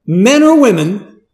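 rising, high and loud zealot quote; voicing a zealous Saul. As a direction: voice Saul's fervor. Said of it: "he says".

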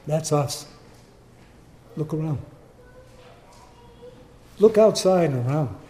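ordinary talk

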